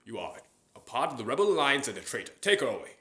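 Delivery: loud imperial command; Darth Vader impression. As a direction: bark the order at the guards. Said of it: "I can do Darth Vader".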